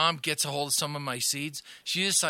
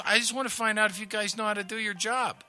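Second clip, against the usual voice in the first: mom's mock innocent voice; 7 semitones higher than usual. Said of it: "she goes".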